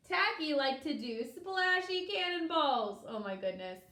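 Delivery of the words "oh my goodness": plain reading voice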